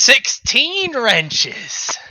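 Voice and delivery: slow dramatic. Acted. GM announces the next attack.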